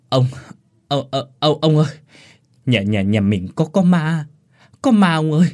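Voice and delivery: gasping, repeating words, barely able to speak; terrified stammering. A narrator's reading. Boy stammers to the old master about a ghost, terrified. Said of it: "lắp bắp".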